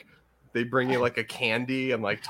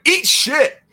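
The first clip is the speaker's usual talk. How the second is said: sudden high-pitched, full-volume shout; loud yell; 11 dB louder than usual. Retold or imitated. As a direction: yell it at the kid as loud as you can. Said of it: "I just yelled as loud as I can".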